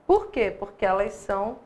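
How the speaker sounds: ordinary delivery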